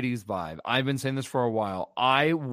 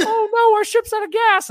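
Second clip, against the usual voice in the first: mocking character voice; 9 dB louder than usual.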